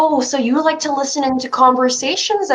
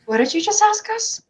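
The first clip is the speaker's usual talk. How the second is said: sudden high disbelief; as if the question stung; shocked; 9 semitones higher than usual. Acted. Willow startled by the guard's colour question, pitched up in disbelief.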